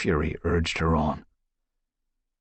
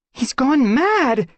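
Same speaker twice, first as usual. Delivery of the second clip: very high, loud, slow shriek; 7 dB louder, slower than usual. Acted. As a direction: shriek it in alarm. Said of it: "Squirrelpaw shrieked".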